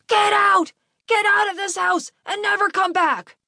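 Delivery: high, raging father's scream; enraged dad, starting at full blast; furious screaming. A narrator's reading. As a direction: scream your child out of the house. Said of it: "his dad was screaming at him".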